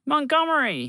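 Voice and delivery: high, loud happy call of a name; Bobby spots his old friend, high excited call; excited greeting. Acted.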